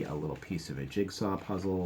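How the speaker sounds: ordinary delivery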